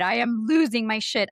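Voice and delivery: normal talking voice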